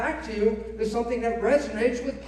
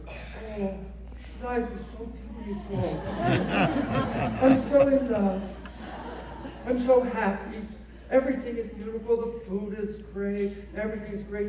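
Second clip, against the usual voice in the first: a lightly played giddy lover sighing over everything; mock lovestruck gush. Voicing a newlywed in love.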